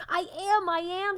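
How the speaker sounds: high, loud desperate cry; shout. A narrator's reading. Son calling to his parents, high loud shout. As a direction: shout it so they hear. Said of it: "Sylvester wanted to shout".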